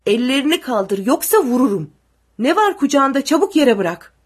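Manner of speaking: loud soldier's orders; urgent, loud threat; barked threat. A narrator's reading. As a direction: shout commands at gunpoint. Armed soldier shouts orders, threatens.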